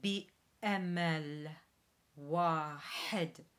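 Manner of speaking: Arabic repetition with glottal stop; Arabic model. Shown as a demonstration. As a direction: say بأمل with a glottal catch. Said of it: "Try again".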